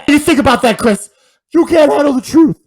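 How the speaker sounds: loud heated rant. Real, not acted. Ranting at Chris about Biden, loud and high. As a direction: really let him have it, full-volume yell.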